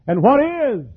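high, ringing preacher's exclamation; fervent cry. Real, not acted. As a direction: voice leaps high on an impassioned question. Preacher's rising exclamatory question.

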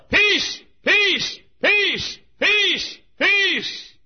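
high, full-voiced repeated shout; hollering messenger. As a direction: holler the news across a battlefield. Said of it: "began to holler".

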